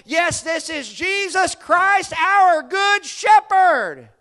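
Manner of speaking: loud, slow, stretched shout; preaching shout. Real, not acted. Preacher to his congregation, a slow ringing shout. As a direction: cry it out at full force.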